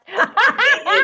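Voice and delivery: bursting into a high loud laugh; real laugh. Real, not acted. Laughing at her own fast talking with her co-host.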